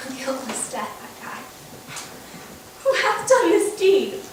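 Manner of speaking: faint, low, failing voice; a murdered wife's last breaths; dying words. Acted. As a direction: barely get the words out as life fades.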